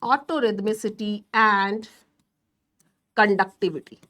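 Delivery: very slow, word by word, for notes; slow dictation. Shown as a demonstration. Teacher dictates a definition to students, slow and deliberate. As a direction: spell it out slowly so students can write.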